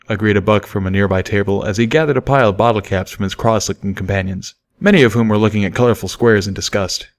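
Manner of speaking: usual speaking voice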